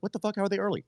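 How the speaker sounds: high, baffled mock outburst; imitated disbelief. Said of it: "you're like".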